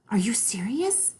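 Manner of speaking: breathless whispered question. Acted. Little sister whispering in shock at scary news.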